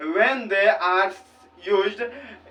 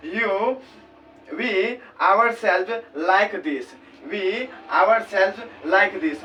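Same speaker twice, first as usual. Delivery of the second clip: very slow dictation read; much slower than usual. Shown as a demonstration. Teacher reads example sentence.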